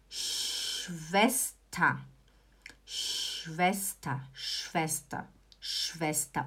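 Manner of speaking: low, soft "Schwester" opening on a long hushing sh; long SH onset. Shown as a demonstration. Teacher showing the SCH sound, softly lengthened "Schwester". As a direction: stretch the "sh" in "Schwester", end in "ta". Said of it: "o S C H você faz som de sh".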